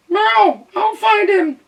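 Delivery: very high, loud meowing cat voice; scoffing cat. A narrator's reading. Cat boasting to the squirrel, high and loud. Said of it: "scoffed the cat".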